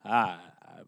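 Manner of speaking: low, soft zombie groan; zombie impression. A zombie going for Trump's brain, low mock groan. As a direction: moan like a zombie after brains. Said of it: "my impression of a zombie".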